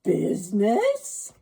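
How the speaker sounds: loud, slow anguished cry. A narrator's reading. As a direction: cry it out in despair, wringing hands. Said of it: "cried the ghost".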